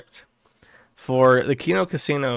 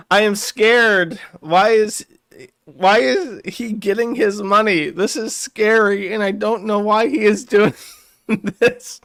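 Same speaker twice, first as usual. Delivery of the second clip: higher, loud, slow hammed-up scared voice; mock fear; 6 semitones higher, 3 dB louder than usual. Worski, voiced in hammed-up fear about the tip. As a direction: ham up being frightened.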